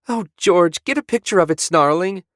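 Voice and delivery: high, loud gushing tourist; excited old wife. Acted. Tourist wife urges George to photograph the Gort, high excited gush. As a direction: squeal it out to her husband.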